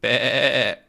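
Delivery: bleating like a goat. Acted. Goat bleating at Snowy, mock bleat. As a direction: bah it out like a goat. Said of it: "goat going".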